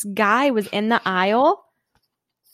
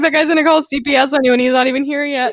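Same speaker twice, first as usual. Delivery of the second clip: laughing warning; 5 semitones higher than usual. Retold or imitated. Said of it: "He's like".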